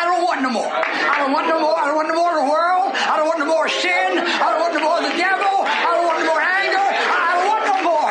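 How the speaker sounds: rising, building preacher's chant; preaching refrain. Real, not acted. Preacher to his congregation, building chant. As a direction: preach it, climbing each line.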